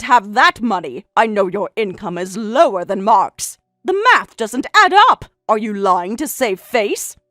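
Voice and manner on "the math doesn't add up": higher, louder accusing; indignant. Acted. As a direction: press the accusation hard. Accusing her of lying.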